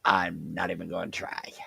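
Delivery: whispered aside under the breath; whispering. Real, not acted. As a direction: drop to a whisper. Muttered aside, unvoiced.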